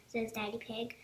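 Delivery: plain reading voice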